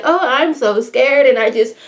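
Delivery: a hammed-up fake fright; mock scared. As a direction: pretend to be a panicky shooter. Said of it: "It wasn't like".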